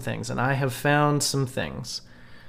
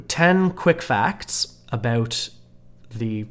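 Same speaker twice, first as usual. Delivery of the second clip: ad read; 3 semitones higher than usual. Acted. Reads promo facts aloud.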